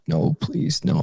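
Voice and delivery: put-on high pleading cry of a scared child; retold scream. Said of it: "I'm just screaming out".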